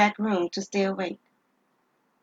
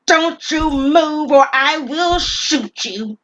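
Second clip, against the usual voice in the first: slow, loud, higher threat; threatening robber; 7 semitones higher, 10 dB louder, much slower than usual. Acted. Gunman warning the clerk he will shoot, slow and loud. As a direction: spell out the threat deliberately.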